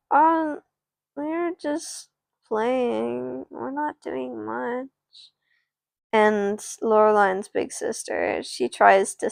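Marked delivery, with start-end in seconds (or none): 0.1-4.9 higher, soft, slow hesitant reply; a touch of meek kid voice; timid little brother. Acted. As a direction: sheepishly explain yourself to an angry dad. Little brother answering his angry dad.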